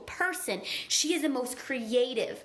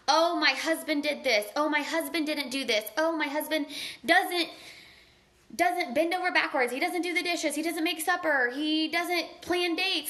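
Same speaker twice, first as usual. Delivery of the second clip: imitating a griping spouse, pitched up; mock girl-talk griping, climbing higher; mock complaining; 4 semitones higher than usual. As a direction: rattle off grievances like a complaining wife. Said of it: "you're complaining about your spouse".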